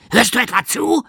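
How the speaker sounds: high, loud gym-teacher snap; loud, high angry challenge; angry coach. Acted. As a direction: demand it like a furious coach. Angry coach confronts giggling boy.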